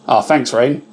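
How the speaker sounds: ordinary delivery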